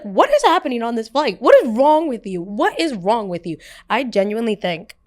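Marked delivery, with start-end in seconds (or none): 0.0-3.6 loud, higher-pitched outraged demand; retold angry yell. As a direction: yell at her in disbelief. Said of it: "the one time that I yelled at her".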